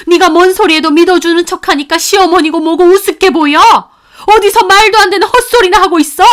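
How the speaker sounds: loud, high, enraged tirade; furious scolding. Acted. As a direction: scream her fury at both of them. Grandmother berates son and wife.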